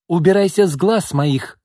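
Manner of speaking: high, loud angry order; acted furious dismissal; barking. A narrator's reading. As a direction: bark it to drive the girl away. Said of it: "рявкнула Изольда".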